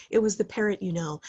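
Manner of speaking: usual speaking voice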